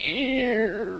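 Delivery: high squeaky beep voice; mock beep squeal. Mimicking the train sound effect, high mock squeak. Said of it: "it's like a beep".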